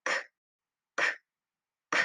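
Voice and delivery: bare "k" sound, repeated with gaps between; "k" as a short voiceless click, no letter name; isolated letter sound. Shown as a demonstration. Teacher making a sound for children to point to, isolated "k" sound. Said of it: "I'm gonna make the sound".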